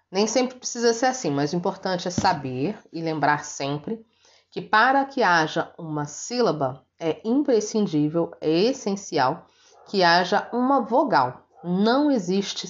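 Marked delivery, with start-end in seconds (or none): none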